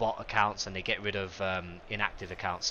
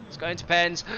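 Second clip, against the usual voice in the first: "It's going to pens" sung out higher and louder; excited higher call; 7 semitones higher, 5 dB louder than usual. Real, not acted. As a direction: shout it like you're sure. Excited repeat of prediction.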